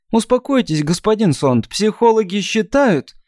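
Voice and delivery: put-on high chirping girl voice; chirpy thin voice. Shatra's voice continued.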